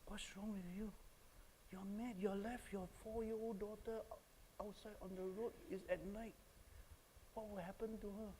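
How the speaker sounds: high, soft imitation of a wife; lightly voiced wife telling husband off; reproachful wife. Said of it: "My wife said".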